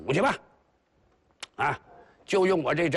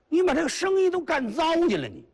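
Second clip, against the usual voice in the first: 训斥; 14 semitones higher than usual. Acted. 老曹训斥两个土匪.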